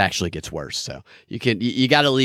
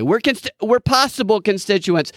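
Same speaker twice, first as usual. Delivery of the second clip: mocking imitation; 7 semitones higher than usual. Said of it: "You heard her say".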